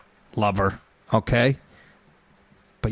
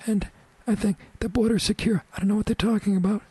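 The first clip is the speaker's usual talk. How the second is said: frail politician insisting, higher and quicker; Harry Reid impression; 10 semitones higher, faster than usual. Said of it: "with a voice like that".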